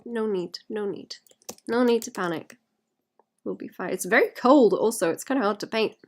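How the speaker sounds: ordinary delivery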